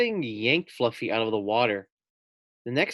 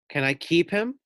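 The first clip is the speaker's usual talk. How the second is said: higher hopeful ask; hopeful girl; 6 semitones higher than usual. A narrator's reading. Girl asking her father to keep the pig, higher and hopeful. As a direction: ask sweetly.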